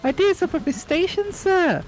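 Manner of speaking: high elf squeak with a touch of sorrow; sad house elf. Acted. Winky worries to Harry about Dobby, sad squeak. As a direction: sigh it out in a tiny squeak. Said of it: "said Winky sadly".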